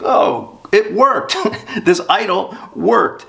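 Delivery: sudden surprised delight, pitched high; mock amazement. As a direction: play a sailor stunned his idol worked. Sailor amazed the idol worked.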